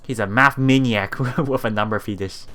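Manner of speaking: ordinary talk, nothing marked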